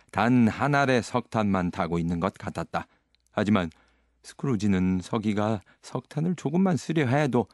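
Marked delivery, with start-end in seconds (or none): none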